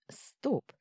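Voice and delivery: sudden whispered stop; sharp whisper. A narrator's reading. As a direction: hiss it out as a startled whisper. Sudden thought to himself.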